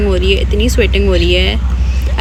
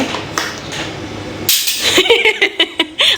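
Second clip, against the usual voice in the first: high breathy giggle; high laugh; 13 semitones higher than usual. Real, not acted. Mother giggling at her playful baby.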